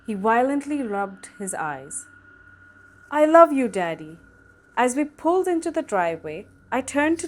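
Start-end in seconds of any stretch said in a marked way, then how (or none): 3.1-4.2 put-on child voice.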